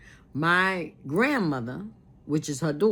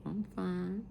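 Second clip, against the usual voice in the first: very soft, slow, higher imitated reply; retold faint reassurance; 4 semitones higher, 10 dB quieter, slower than usual. Sick mother answering her worried daughter, retold softly. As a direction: answer faintly and slowly, as the sick woman did.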